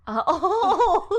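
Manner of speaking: high, loud laughing "oh". Real, not acted. Laughing at the other's answer.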